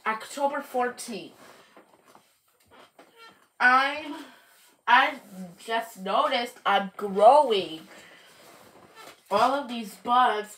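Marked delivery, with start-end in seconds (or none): none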